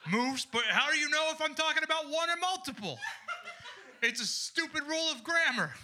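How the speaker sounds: high-pitched comic indignation; mock outraged rant. Comedian declaring war on everyone tonight, mock outraged.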